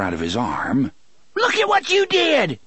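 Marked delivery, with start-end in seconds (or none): 1.3-2.6 high-pitched shriek; high accusing scream. A narrator's reading. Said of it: "Tilly screamed".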